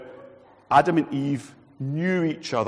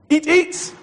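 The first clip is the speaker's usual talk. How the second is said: high eager urging; 12 semitones higher, 6 dB louder than usual. Retold or imitated. God urging Adam.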